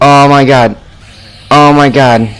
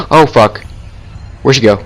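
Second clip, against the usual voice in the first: normal talking voice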